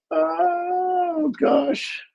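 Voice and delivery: ordinary talk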